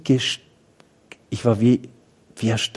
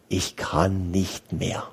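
slow, much softer, exhausted confession; weary despair; 5 semitones lower, 8 dB quieter, much slower than usual. Retold or imitated. Priest voicing his own exhaustion to Jesus, slow and very soft. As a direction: let it sink out, spent and drained. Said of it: "Wie viele schreien so?".

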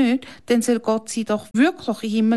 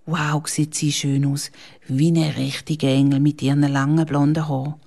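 usual speaking voice

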